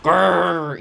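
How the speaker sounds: loud sulky growl. A narrator's reading. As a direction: growl as you slink off. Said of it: "he growled".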